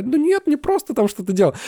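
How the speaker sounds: mock dramatic reply. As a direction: squeak out an over-the-top reply. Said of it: "И он такой".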